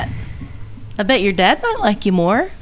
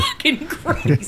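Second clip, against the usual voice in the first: laughing; 3 semitones higher, 4 dB quieter, slower than usual. Real, not acted. Repeats friend, laughing.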